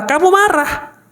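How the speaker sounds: high-pitched inner-voice retort; lightly voiced subconscious; subconscious answering back.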